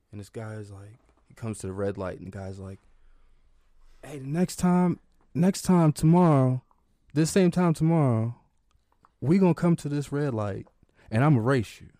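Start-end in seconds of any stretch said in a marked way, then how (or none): 4.0-11.9 higher, louder street racer taunt; a loud, higher dare; cocky challenger. Retold or imitated. Stranger at red light dares granddad to race, louder and higher.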